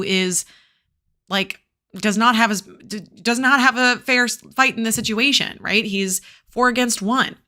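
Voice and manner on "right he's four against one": ordinary delivery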